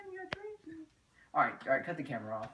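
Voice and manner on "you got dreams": mocking squeak. Mocking taunt in a staged confrontation.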